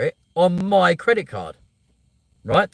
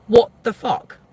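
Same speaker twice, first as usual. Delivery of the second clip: shocked outburst; 7 semitones higher, 6 dB louder, slower than usual. Retold or imitated. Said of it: "And I was just like".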